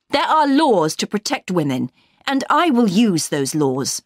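heated, emphatic threat; understated furious shout. A narrator's reading. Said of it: "I shouted furiously".

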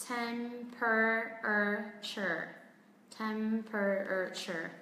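very slow Temperature, tem-per-a-ture; syllable by syllable. Shown as a demonstration. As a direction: sound out Temperature with gaps between syllables. Teacher sounds out new word.

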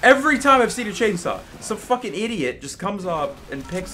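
high, loud exasperated yell; frustrated rant. Real, not acted. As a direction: rant in disbelief.

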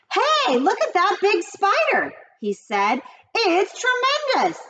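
very high, eager cry; excited boy. A narrator's reading. Avery spotting the big spider, very high and excited. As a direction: gasp with delight at the spider.

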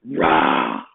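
sleepy lion roar; yawning roar. A narrator's reading. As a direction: roar melting into a yawn. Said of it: "roar turned into a yawn".

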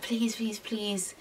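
soft, hushed begging, fading away; retold plea, trailing into softness; mock pleading. Retells him begging for it.

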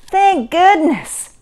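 high, loud, drawn-out relief; relieved. A narrator's reading. Mother reaches the theater in time, relieved exclamation. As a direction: let relief pour out slowly. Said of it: "sighed in relief".

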